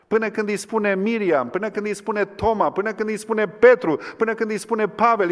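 high, loud, driving sermon climax; fiery pulpit preaching; impassioned preaching. Acted. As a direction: preach it out, building and pressing. Preaching at full force.

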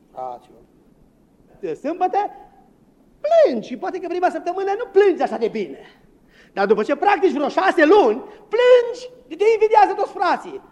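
high, loud, theatrical preaching with a laugh; mocking hypocrite preacher. Preacher parodying a pastor who practices crying. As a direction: ham up a showy weeping pastor. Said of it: "ce spune predicatorul".

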